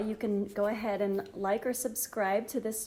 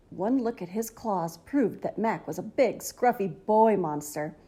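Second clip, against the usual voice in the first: ordinary delivery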